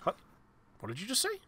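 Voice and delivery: high, startled squirrel; high-pitched little critter voice. Acted. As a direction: squeak it out like a surprised squirrel. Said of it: "looks up at you and goes".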